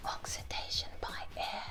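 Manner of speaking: soft unvoiced whisper. Shown as a demonstration. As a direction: breathe it out in a whisper. Repeats answer in a whisper.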